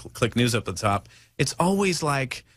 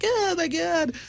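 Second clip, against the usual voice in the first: squeaky high crybaby wail; mock whiny crybaby; slower than usual. Mocking over-sensitive people as whiny toddlers. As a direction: whine it like a crying toddler in a pen.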